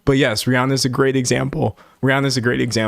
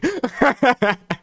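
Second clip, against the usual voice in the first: high laugh; 12 semitones higher than usual. Real, not acted. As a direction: burst of high-pitched laughter. Laughs at own mistake.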